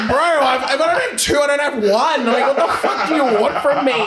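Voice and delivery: loud, high yell breaking into laughter; laughing exasperated shout. Retold or imitated. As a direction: shout it in disbelief, half laughing. Refusing the girl's second request.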